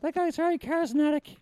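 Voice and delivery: high ventriloquist's puppet. Acted. Puppet admires the magician after the bit, high puppet voice. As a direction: pipe up small and high as the puppet.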